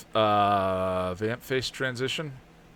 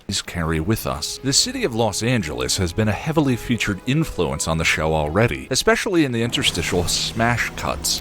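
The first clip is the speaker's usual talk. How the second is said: steady voiceover narration; narrator read; 8 dB louder than usual. Shown as a demonstration. Voiceover take again.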